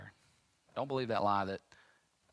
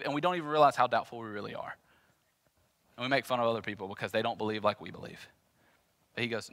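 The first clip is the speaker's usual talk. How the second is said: ordinary delivery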